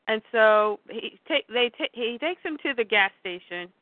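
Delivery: ordinary delivery, nothing marked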